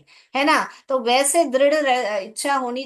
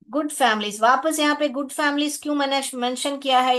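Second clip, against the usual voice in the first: ordinary delivery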